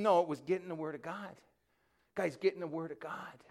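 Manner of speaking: ordinary talk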